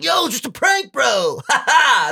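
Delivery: high-pitched mock yell with a forced laugh; obnoxious prankster. Prankster laughing off his victim's hurt, obnoxious mock yell. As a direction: shout it like a cocky prankster brushing it off. Imitated prankster excusing himself.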